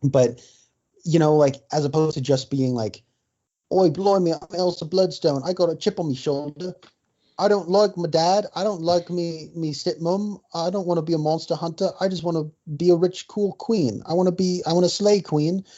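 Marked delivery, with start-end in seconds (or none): 3.7-15.6 higher-pitched hammy Cockney parody; exaggerated British character voice, pitched up; mock British accent. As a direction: put on a cartoonish "oi, blimey" British accent. Parodying British Elsa Bloodstone character.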